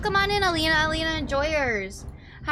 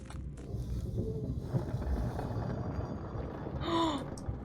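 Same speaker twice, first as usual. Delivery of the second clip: shocked gasp; 5 semitones higher, 10 dB quieter than usual. Real, not acted. Shocked at secret.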